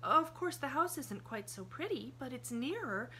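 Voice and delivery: put-on high child's voice, chatty and sure; a girl happily explaining the plan; cheerful little girl. A narrator's reading. Pollyanna's voice, matter-of-fact.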